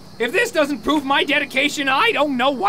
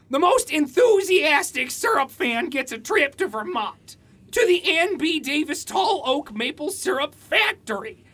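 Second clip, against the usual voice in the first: ordinary delivery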